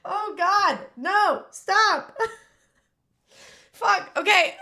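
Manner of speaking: high, loud tearful protest; crying and laughing. Real, not acted. Streamer trying to stop crying on stream, tearful laughing. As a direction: laugh helplessly through real tears. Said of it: "crying and laughing".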